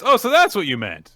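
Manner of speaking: high, loud acted "oh, I get it"; sudden realisation. Iamori reacting to Kana's confession, sudden realisation. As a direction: exclaim it as the penny drops.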